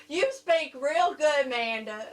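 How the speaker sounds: hammed-up Southern therapist; thick Southern accent. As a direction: imitate a speech therapist with a heavy Southern twang. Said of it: "had a really thick Southern accent".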